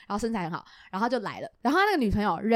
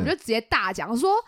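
ordinary talk

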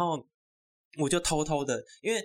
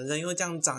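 usual speaking voice

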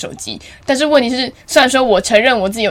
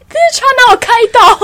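much higher, laughing through the words; laughing indignation; 13 semitones higher, 5 dB louder than usual. Retold or imitated. Thinking about the teacher picking on her, laughing protest. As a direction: protest while cracking up. Mock indignant inner thought.

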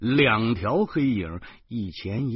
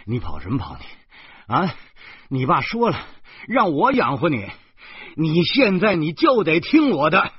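fierce scolding; 7 semitones higher, 5 dB louder than usual. Acted. Guardian threatening the girl on the bridge, fierce scold. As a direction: lay down the law, menacing. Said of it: "我恶狠狠地说".

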